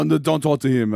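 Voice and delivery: ordinary talk, nothing marked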